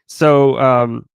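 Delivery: ordinary talk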